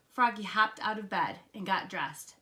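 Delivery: ordinary delivery, nothing marked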